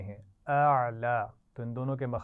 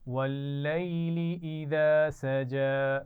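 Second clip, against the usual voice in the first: teacher chanting Quran for students to echo; Quranic recitation; 2 semitones higher, 4 dB louder, much slower than usual. Shown as a demonstration. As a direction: model the verse slowly for the class to repeat. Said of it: "मेरे बाद पढ़िए".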